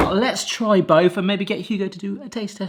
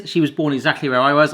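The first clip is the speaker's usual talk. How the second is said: ordinary talk, nothing marked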